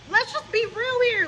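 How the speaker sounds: high, loud burst; emphatic high call; exclaimed. Real, not acted. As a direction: shout it out for real.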